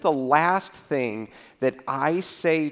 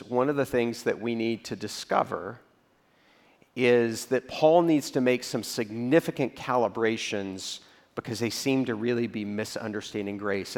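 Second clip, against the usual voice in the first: ordinary talk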